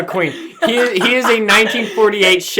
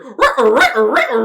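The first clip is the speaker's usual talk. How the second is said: Scooby-Doo voice; 4 semitones higher, 4 dB louder than usual. Retold or imitated. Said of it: "the entire time you can hear".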